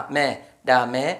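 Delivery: normal talking voice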